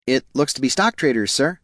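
tentative reply to the boss; deferential underling. A narrator's reading. Underling answers his boss cautiously.

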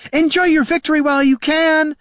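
angry high-pitched shout; hollering. Acted. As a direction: holler a threat after departing foes. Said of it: "she hollered after them".

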